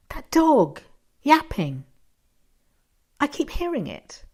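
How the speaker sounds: insistent. Acted. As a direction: press the point, voice up.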